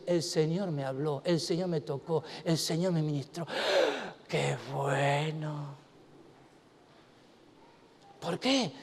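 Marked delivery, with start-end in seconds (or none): 0.1-4.3 awed hush. Acted. Quoting believers in awe. 4.3-5.8 awed gasp. Acted. Gasp then awe.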